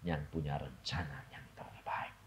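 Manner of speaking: sudden whisper; hushed. Real, not acted. Preacher to congregation after a shout, hushed whisper. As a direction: drop to a whisper for effect.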